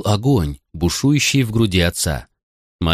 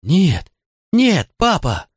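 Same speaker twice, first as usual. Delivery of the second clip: put-on high, wailing child's protest; tearful high-pitched pleading; crying boy; 10 semitones higher, slower than usual. A narrator's reading. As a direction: cry out to Dad in denial. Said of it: "плакал мальчик".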